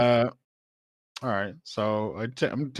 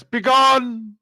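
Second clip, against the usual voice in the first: high, loud and grand; lofty voice; 13 semitones higher, 9 dB louder than usual. Acted. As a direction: command like a haughty deity. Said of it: "I'm just gonna put on the lofty voice".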